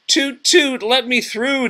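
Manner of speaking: high, loud toot and demand; demanding tugboat. Acted. Tugboat to the log men, high demanding toots. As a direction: insist on passing, pitched high. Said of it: "demanded Scuffy".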